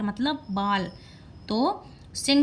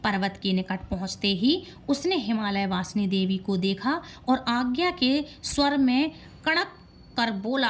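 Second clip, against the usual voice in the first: usual speaking voice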